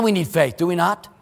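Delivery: ordinary talk, nothing marked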